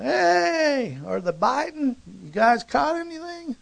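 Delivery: high, loud long-distance call; hollering out. Acted. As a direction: holler across the water to a boat. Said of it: "he hollers out".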